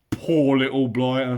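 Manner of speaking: loud troll voice, softened by pity; full of beer, a bit sorry for him. Acted. William pities Bilbo, loud troll.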